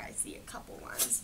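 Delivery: soft unvoiced whisper; hushed aside to the listener. Real, not acted. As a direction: whisper it like a little secret. Reading aside to the child.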